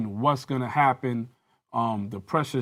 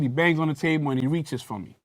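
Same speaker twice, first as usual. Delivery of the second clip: ordinary delivery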